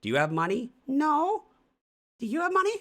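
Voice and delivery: loud, very high falsetto exchange; mock networkers. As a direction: squeak it out like hammed-up eager strangers. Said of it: "and they're like".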